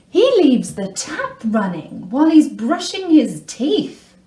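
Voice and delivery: exasperated, with swooping stresses; exasperated complaint. Acted. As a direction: rant about it in exasperated disbelief. Complaining about partner's annoying habits.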